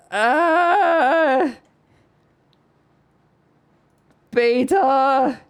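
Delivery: overjoyed squeals, voice cracking up high; ecstatic screaming. Real, not acted. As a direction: scream it like the best news ever. Screaming over beta announcement.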